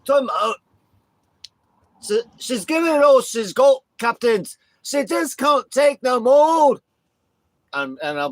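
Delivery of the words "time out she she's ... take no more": shouted, high-pitched Scottish plea; Scottish accent. Pretend. Tag partner stopping the beating, shouted Scottish accent. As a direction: holler it in hammed-up Scottish, stretching the words. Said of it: "I'm gonna be like".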